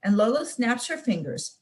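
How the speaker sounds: usual speaking voice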